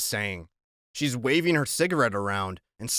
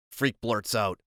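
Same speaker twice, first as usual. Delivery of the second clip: ordinary delivery, nothing marked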